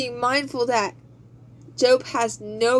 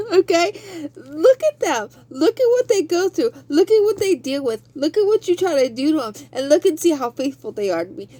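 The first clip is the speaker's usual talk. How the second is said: God bragging; 5 semitones higher, faster than usual. Acted. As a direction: hammer consider over and over. Preacher voicing God at full force.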